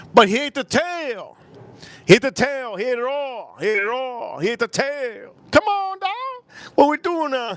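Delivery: high, incredulous ranting; worked up. Real, not acted. Telling chat about father eating tail, incredulous. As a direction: keep cranking up the disbelief. Excited retelling, high pitched.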